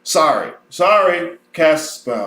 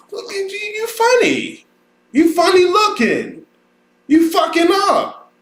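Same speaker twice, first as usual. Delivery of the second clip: mock teacher; 11 semitones higher than usual. Mocking a condescending teacher.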